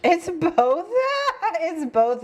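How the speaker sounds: high-pitched, giggly burst; giggling, pitch leaping up; laughing. Real, not acted. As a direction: laugh through the words. Laughing at both meanings.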